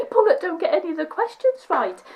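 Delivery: quick, high-pitched mild panic; mock dread. Contestant quotes her worry about failing like radio quizzers, mock dread. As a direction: rush it out in comic dismay. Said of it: "it makes me feel so dreadful".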